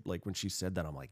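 ordinary talk, nothing marked

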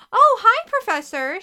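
very high, loud surprised greeting; exaggerated high-pitched surprise, easing on the last word; feigned surprise. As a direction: pretend to have only just noticed him. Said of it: "said in a surprised voice".

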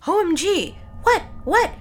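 high, loud OMG outburst; over-the-top startled reaction; shocked excitement. Acted. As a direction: exclaim it, hammed up. Acting shocked reaction in story.

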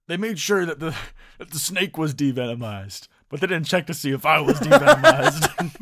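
Macho Man quote. Retold or imitated. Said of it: "he was like".

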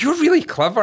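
high, gushing praise; high impressed voice. Retold or imitated. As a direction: gush up high like her. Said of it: "And then she's like".

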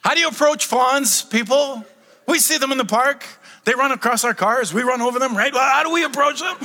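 usual speaking voice

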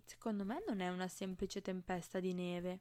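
ordinary delivery

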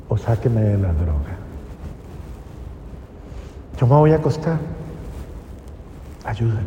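a broken man's last quiet plea; hushed despair. Retold or imitated. Addict kneeling by his bed giving up to God, hushed.

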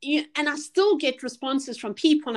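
usual speaking voice

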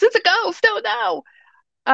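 high, panicked shriek of fear; frightened cry. Acted. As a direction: cry out like scared disciples seeing a ghost.

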